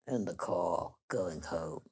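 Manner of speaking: a low, soft Marlon Brando mumble; Marlon's low murmur. Retold or imitated. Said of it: "He said".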